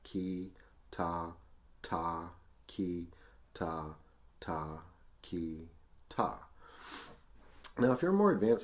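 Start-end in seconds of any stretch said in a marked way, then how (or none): 0.0-6.4 slow, separated syllables in threes; slow counting. Shown as a demonstration. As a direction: space out each syllable evenly for learners. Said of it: "Let's say that slow".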